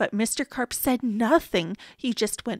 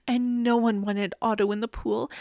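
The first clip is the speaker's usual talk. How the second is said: ordinary delivery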